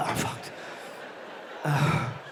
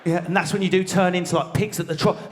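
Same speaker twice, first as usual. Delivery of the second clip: ordinary delivery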